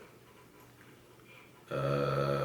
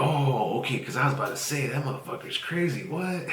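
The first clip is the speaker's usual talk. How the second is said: much higher, quick mock relief; 8 semitones higher than usual. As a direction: voice the caller high and fast. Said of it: "He's like".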